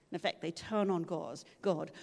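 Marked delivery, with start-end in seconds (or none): none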